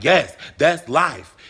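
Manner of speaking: loud, slow mock-exasperated yes; emphatic retold retort. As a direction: punch it out slowly, imitating his retort. Acting out the man's curt reply.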